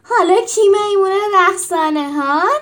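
loud high jeer through laughter; old gladiator jeering from the balcony; mocking laughing shout. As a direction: taunt him while cackling. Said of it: "داد زد".

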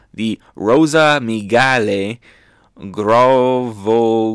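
ROSA MIGALE GROVOGELI sounded out slowly, syllable by syllable; slow, deliberate attempt at "Rosa Migale Grovogeli", each vowel stretched; careful pronunciation. Shown as a demonstration. Reviewer trying a hard species name, "Rosa Migale Grovogeli" slowly sounded out. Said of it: "let me try to pronounce this correctly".

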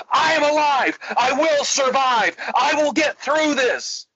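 very high, ringing declarations; triumphant affirmation. Retold or imitated. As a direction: imitate a client finally shouting with conviction. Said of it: "by the end of the session".

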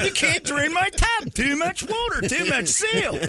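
very high, over-excited pitchman; Flex Seal guy, excited. Acted. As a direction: blare it like a fanatical infomercial host. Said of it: "He's so excited about his stupid seal".

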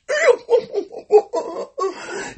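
high-pitched cackling laugh. Real, not acted. Laugh after the bit.

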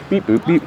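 high, sing-song beep boop noises; playful robot beeps. Pretend. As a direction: mimic a little machine beeping. Playful beeps while checking time.

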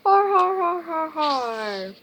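loud, high mock guffaw, tailing down; acted villainous laugh; laughing sharks. A narrator's reading. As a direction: belt out a storybook "har, har" for the sharks. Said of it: "sharks laughed".

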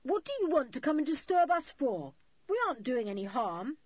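high, loud protest; indignant shout. A narrator's reading. Julian asks the couple why they disturb them, shouting. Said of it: "shouted Julian".

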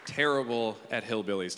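usual speaking voice

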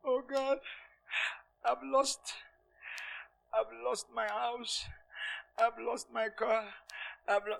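very soft, halting lament with long pauses; hushed, mock-tearful prayer; acted crying man. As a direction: play a broken man quietly grieving his losses. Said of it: "Mister Nyatela is crying".

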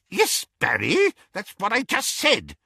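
put-on high, impatient old aunt; testy. A narrator's reading. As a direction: snap back, irritated. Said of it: "said Auntie Muriel testily".

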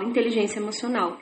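quick, soft, smooth; rattled off at a glance; fast fluent reading. Shown as a demonstration. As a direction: glide through it like a practiced reader. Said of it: "E eu leio e falo".

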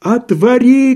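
высокий громкий крик; кричать, дрожа всем телом; крик с дрожью. Acted. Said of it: "крикнул Андрей Ефимович, дрожа всем телом". (Рагин кричит санитару, дрожа всем телом)

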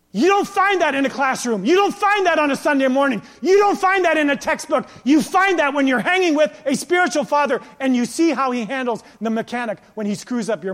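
loud, high, hammering preacher's delivery; impassioned preaching. Real, not acted. Preacher exalting spiritual fathers over classrooms, impassioned preaching. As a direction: drive each repeated point home forcefully.

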